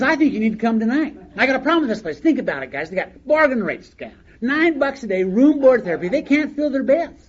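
loud, pushy saleswoman talking fast at the caller; hard-sell the bed, voice up and loud; high-pressure sales pitch. Retold or imitated. Saleswoman pushing a caller into a bed tonight, loud hard sell. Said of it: "high pressure me".

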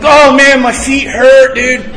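loud, high groan of complaint; mock pained whine. Man moaning to a caller about his feet, whiny. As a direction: play up the pain for sympathy.

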